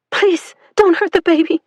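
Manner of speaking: high, loud, tearful plea; mother pleading with soldiers, crying out; desperate cry. Acted. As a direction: beg for the baby's life. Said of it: "Celine cried".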